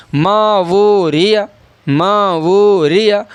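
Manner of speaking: slow, long "ما" and "وہ", quick final "a" in "ریا"; drawn-out recitation. Shown as a demonstration. Teacher modelling long vowels for learners, slowly stretched "ما وہ". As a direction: hold "ما" and "وہ", release "ریا" at the end. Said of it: "آپ کو دیکھنا ہے یہاں الف مدہ".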